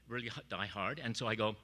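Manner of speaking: ordinary talk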